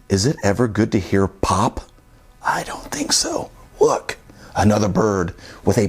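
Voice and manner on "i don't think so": high unvoiced whisper. Real, not acted. Whispering the answer to the child. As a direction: answer it as a secret.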